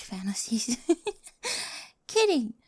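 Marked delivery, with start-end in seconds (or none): 2.1-2.5 laughing it off with a giggle; playful laughing kidding. Acted. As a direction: chuckle through the word. Laughs off her revenge joke.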